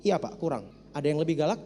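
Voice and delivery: low, soft, unbothered; cool cheeky reply. Retold or imitated. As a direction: answer like it's nothing. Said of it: "Saya bilang".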